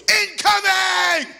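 long drawn-out high yell; shouted warning. Retold or imitated. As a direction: bellow it like a soldier under fire. Warning shout as shots fired.

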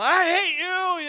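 slow, high, loud mock wail; childish tantrum. Hurt pupil storming out on his teacher, mock tantrum. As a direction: imitate a kid storming out yelling. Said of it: "I stormed out of the classroom".

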